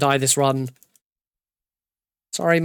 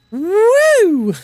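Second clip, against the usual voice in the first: high, loud whoop of glee; excited whoop; 6 dB louder than usual. Real, not acted. Streamer whooping as Johnny Sasaki appears, high and gleeful. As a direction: cheer at the sight of Johnny.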